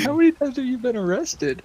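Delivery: incredulous. Retold or imitated. Incredulous question retold, laughing.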